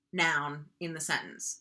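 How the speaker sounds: ordinary talk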